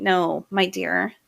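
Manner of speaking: ordinary talk, nothing marked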